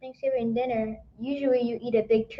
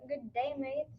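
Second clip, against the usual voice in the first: softer, higher English accent attempt; 4 semitones higher, 10 dB quieter, slower than usual. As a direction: try the English accent once more, quietly. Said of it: "talk in your best English accent".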